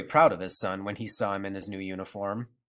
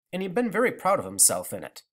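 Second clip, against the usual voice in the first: plain reading voice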